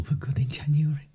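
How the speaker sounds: conspiratorial whisper; hushed insider tip. Real, not acted. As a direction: lean in and whisper the secret. Revealing insider information.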